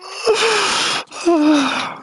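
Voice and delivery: high evil cackle; villain laugh. Real, not acted. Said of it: "making me fucking do my villain laugh".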